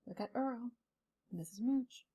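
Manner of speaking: very soft, hushed aside; barely voiced comment to the listeners; quiet aside. Real, not acted. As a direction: step out of the story and point out the pictures softly. Aside while showing pictures to listeners.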